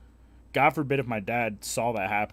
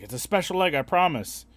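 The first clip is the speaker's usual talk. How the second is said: put-on higher, louder eager kid; kid's voice; 7 semitones higher, 6 dB louder than usual. Kid promising his egg is special, higher and louder. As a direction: insist like a pushy little boy. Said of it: "He's like".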